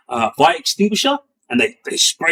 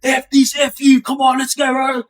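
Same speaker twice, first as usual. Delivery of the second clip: mock going off; 8 semitones higher than usual. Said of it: "going off".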